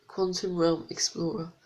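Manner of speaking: ordinary talk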